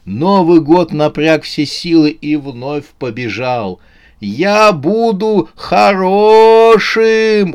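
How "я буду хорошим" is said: high, loud, very drawn-out cry; desperate shout. A narrator's reading. Новый год promises before diving into the forest, shouting. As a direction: yell the promise before vanishing. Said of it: "успел прокричать он".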